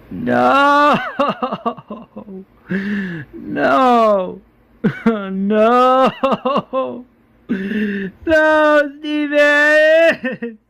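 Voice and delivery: high, loud drawn-out crying; hammed-up anguished howl, voice sobbing; acted wailing grief. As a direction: wail over a dead friend. Alice mourns the dead doctor.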